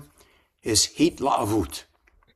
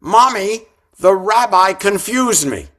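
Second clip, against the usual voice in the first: outdoor voice; 8 semitones higher, 7 dB louder than usual. Retold or imitated. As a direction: blurt it out loud like a small child. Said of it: "says in her outdoor voice".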